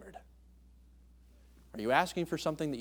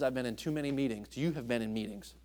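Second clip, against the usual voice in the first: normal talking voice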